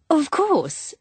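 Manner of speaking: high-pitched exclamation; exclaiming cry. A narrator's reading. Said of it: "cried Jennifer".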